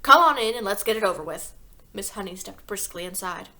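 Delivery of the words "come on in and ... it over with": loud, curt snap; snapped. A narrator's reading. Father letting the teacher in, loud grudging snap. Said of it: "he snapped".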